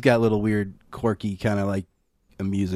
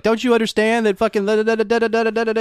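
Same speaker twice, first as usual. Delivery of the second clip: retold yelling; 9 semitones higher, faster than usual. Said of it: "that one guy is just yelling at him".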